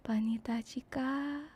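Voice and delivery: soft breathy whisper, swooning. Retold or imitated. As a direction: whisper it close like a sweet nothing in the ear. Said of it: "I'm like".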